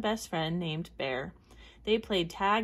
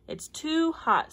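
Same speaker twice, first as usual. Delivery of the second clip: higher, slow, whiny complaint; 8 semitones higher, 4 dB louder, slower than usual. A narrator's reading. Frank joins the friends, complaining high and slow about heat. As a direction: drag it out high like a sulky kid.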